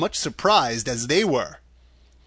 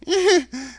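high little-man titter; short, high embarrassed laugh; nervous chuckle; 4 dB louder than usual. A narrator's reading. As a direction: giggle sheepishly.

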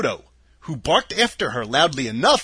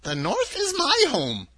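put-on much higher, kindly old-lady voice; a sweet little old woman, high and gentle; old witch of the North; 12 semitones higher than usual. A narrator's reading. Witch of the North telling Dorothy her home, high and kindly. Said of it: "said the old lady".

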